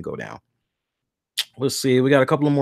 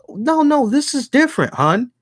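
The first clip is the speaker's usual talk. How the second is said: lightly voiced girlfriend, pitched higher; a hint of his girlfriend, higher and sure of herself; girlfriend correcting him; 11 semitones higher than usual. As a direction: chirp it like a partner setting him straight. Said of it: "my girl goes".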